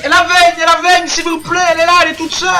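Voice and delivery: high, loud excited call; excited esports caster. Real, not acted. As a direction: shout out the play as it happens. Caster calling a team fight.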